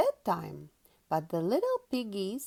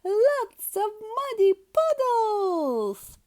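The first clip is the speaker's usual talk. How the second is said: delighted squeal; 12 semitones higher, 7 dB louder, slower than usual. Acted. Peppa thrilled by puddles.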